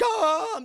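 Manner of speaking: high, loud mock wail; freaked-out cry. Guest meeting living objects, freaked out. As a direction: cry out in exaggerated fright. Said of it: "she's just freaked out".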